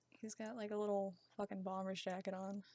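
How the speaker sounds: ordinary delivery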